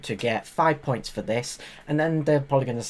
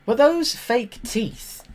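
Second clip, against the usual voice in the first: incredulous; 8 semitones higher, slower than usual. Real, not acted. Incredulous at contestant's teeth.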